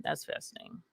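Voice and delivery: breathy whispered aside; whispering. Acted. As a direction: whisper it under your breath. Repeats phrase as a whisper.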